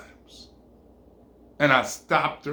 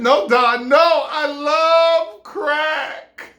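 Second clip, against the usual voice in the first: high, loud, drawn-out declaration; emphatic shout; 11 semitones higher, 7 dB louder, much slower than usual. Acted. Woman insists to him she loves crack, shouting. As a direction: shout it, stretching the last words.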